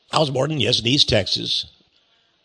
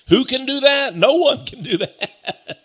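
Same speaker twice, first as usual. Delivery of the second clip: laughing through it, higher pitched; amused laughter; 10 semitones higher than usual. Real, not acted. Preacher scoffing at the devil's chances, laughing. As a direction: say it through a rising chuckle.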